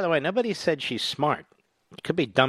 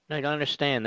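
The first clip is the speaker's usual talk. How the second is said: normal talking voice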